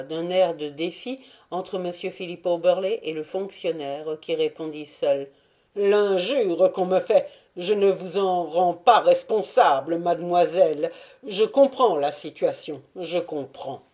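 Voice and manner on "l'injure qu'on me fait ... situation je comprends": a louder, offended man forcing words out past a tight throat; strained, insulted prefect. A narrator's reading. The prefect answering the insult, voice strained from a tight throat. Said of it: "La voix s'échappait avec peine de la gorge serrée".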